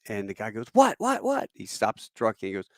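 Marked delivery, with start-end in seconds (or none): 0.7-1.5 high-pitched, startled mimicked kid; startled reply. Young driver answers the sudden order to stop, startled. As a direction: jump in, alarmed and high.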